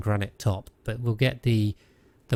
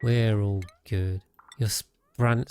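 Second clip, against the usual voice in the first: normal talking voice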